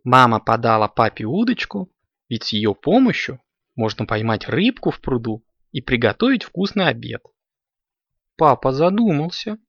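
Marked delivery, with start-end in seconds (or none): none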